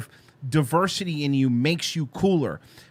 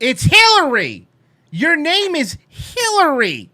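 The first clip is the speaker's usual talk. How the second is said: slow, loud insistence on the name; insisting; 8 semitones higher, 7 dB louder, slower than usual. Real, not acted. Mocking host insists loudly and slowly on her real name. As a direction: spell it out slowly and loudly, fed up.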